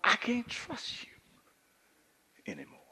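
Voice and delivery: slow breathy whisper of a hurt man; whispered quote. Retold or imitated. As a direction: let it fall into a slow whisper. Job's words whispered.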